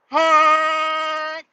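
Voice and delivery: long, high, loud horn blast; imitated air horn. Guy scaring the dogs with an air horn, blaring honk. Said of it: "And I hear".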